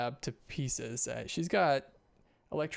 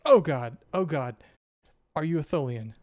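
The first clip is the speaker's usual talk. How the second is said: loud startled outburst, settling into a question; startled doctor; 7 dB louder than usual. Acted. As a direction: jump back in alarm, acted. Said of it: "she jumps back and knocks".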